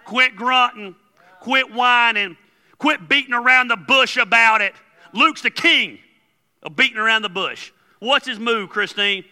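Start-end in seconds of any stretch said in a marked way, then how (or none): 0.1-4.8 loud, high, emphatic scolding; forceful orders with the first words stretched out; exasperated God. Acted. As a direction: lay down the law like a fed-up parent. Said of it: "God looks down and says".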